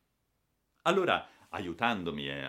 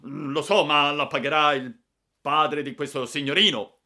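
loud, high, angry reply; raised voice full of resentment; seething retort; 7 semitones higher, 11 dB louder than usual. A narrator's reading. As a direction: answer with withering fury at the boy. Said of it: "come se mi avesse voluto incenerire".

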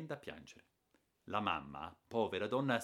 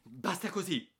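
higher, louder cutting-off cry; angry shout; 10 semitones higher, 5 dB louder than usual. A narrator's reading. Aunt interrupting the boy's excuses, angry shout. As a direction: cut him off with a shout. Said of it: "ha gridato la zia interrompendomi".

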